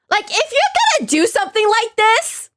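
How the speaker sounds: high, exasperated complaint; upset rant. Real, not acted. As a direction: push the pitch up in protest. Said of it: "I'm so upset at this game".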